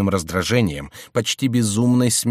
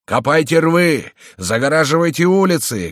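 shouted command; 7 semitones higher, 4 dB louder than usual. A narrator's reading. Commander to his men in street fighting, shouting. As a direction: call it out loudly to troops under fire. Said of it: "кричал он".

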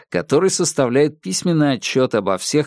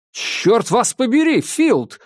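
shouting; 10 semitones higher, slower than usual. A narrator's reading. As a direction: shout it at him in fury. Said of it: "вскричал я". (гневный выкрик)

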